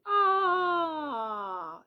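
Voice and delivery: loud, high, held vowel आ; long open aah. Shown as a demonstration. Mother shows the child the sound from the throat. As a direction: open the mouth wide and hold आ. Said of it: "इस तरीके से".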